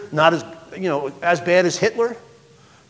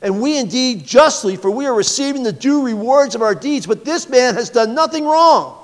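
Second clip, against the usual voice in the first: rebuking; 6 semitones higher than usual. Acted. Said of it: "the other rebuked him".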